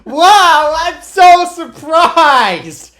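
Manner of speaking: very high, loud mock amazement; sarcastic surprise. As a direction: overblown fake surprise, drawn out slowly at a shout. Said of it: "I was being sarcastic".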